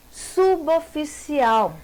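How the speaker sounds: Suboficial stretched slowly, each sound sustained; slow, drawn-out word. Shown as a demonstration. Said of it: "como separa".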